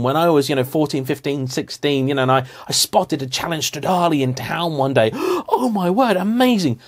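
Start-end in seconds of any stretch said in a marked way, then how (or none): 5.1-6.8 gasping, high and thrilled; excited gasp. Retold or imitated. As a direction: relive the teenage thrill of spotting it. Spotting a rare supercar as teen.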